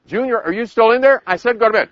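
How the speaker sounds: put-on high, loud calling to child; acted mom losing patience; mother scolding. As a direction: raise your voice at Junior. Mother raising voice at ignoring child.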